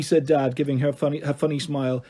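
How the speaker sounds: plain reading voice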